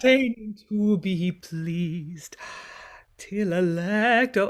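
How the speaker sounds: very slow, drawn-out seductive line; seductive reading. Shown as a demonstration. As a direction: draw out every word to lure. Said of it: "it's almost like a seduction".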